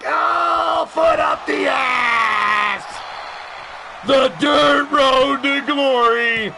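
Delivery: high shouted match call, stretching the big moments; excited play-by-play. Real, not acted. Announcer calls the winning kick, high excited shout. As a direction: build to the winning move, drawing out the finish.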